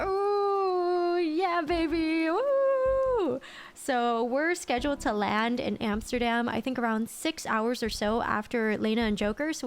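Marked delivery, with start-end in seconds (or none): 0.1-3.4 high, drawn-out celebratory whoops; cheering on landing; excited whoop. Real, not acted. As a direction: whoop it up with joy.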